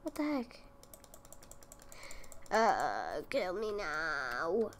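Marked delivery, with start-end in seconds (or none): none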